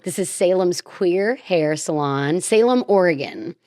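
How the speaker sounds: ordinary delivery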